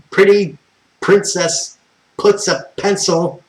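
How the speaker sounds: loud, high, slow and deliberate phrase; loud spelling-out. Retold or imitated. Loudly spelling out P for the phone agent. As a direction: spell it out for someone who won't get it.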